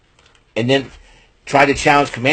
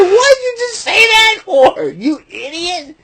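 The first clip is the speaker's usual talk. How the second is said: laughing mock voice; slower than usual. Laughing at his own bit.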